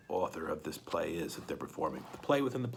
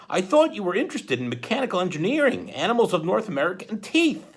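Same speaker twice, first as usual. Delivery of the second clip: high, chirpy judge voice; a kindly judge's high-pitched concern; Justice Strauss; 9 semitones higher, 7 dB louder than usual. Acted. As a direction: fuss over the kids in a bright high voice. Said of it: "Justice Strauss said".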